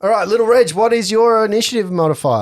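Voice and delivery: excited. Real, not acted. GM calls out loudly.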